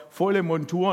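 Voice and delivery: ordinary talk, nothing marked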